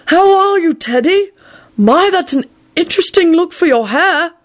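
high, airy kindly elder voice; delighted Dumbledore. Acted. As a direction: greet the boy with pleased surprise. Dumbledore greets Teddy.

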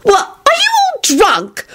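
very high, slow shout; a very high shocked shout. Acted. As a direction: demand it, appalled. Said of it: "Solange shouted".

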